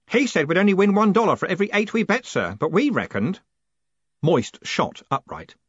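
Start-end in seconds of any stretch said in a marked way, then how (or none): 0.1-3.4 high, louder, keen clerk; eager Stanley, explaining. Acted.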